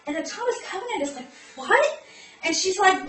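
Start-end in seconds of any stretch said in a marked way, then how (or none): 1.6-2.0 high, loud mock bewilderment; baffled exclamation. Covenant answering Atrium's sudden warning, baffled. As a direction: blurt it out as a startled question.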